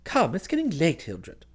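coaxing cousin. Acted. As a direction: humour him gently homeward. Cousin coaxes him to leave.

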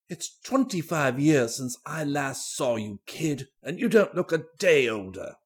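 a lightly voiced hearty old fellow; old Danby, fondly. A narrator's reading. Old Danby greets long-lost Julie.

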